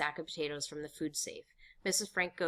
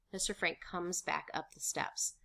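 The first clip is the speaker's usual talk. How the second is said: ordinary delivery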